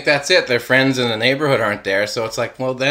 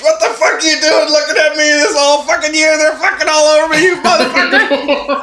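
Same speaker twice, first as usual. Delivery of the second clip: high, fast, loud furious rant; an oak tree yelling at the homeowner; 5 dB louder, faster than usual. Pretend. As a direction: scream it like a tree eaten alive. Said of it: "That's the tree yelling at you".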